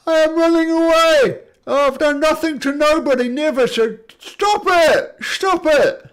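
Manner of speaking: loud, high-pitched wailing monster voice; panicked fleeing monster. Acted. Game master voicing a fleeing monster begging them to stop, panicked shriek. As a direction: shriek for mercy as a hammed-up monster.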